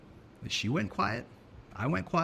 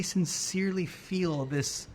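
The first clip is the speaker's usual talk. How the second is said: normal talking voice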